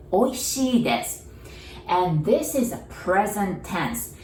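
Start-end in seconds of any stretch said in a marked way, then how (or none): 0.1-1.2 "Oishii desu" with the stress on "Oishii" and a falling end; teacher models the polite "Oishii desu", the "desu" nearly "dess"; formal Japanese demo. Shown as a demonstration. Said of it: "add des after and say".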